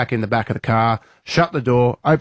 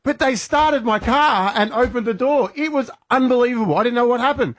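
much higher, breathless amazement; amazed; 10 semitones higher than usual. Retold or imitated. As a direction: gush like it was pure magic. Amazed retelling of the magic unlock.

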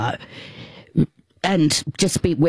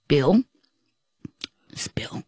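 normal talking voice

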